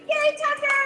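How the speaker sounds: high excited cheer. Real, not acted. Cheering the birthday child.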